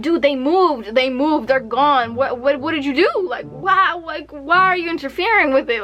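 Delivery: retold frantic exasperation; freaking out. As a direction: act out freaking out on a call. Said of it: "I'm, like, freaking out".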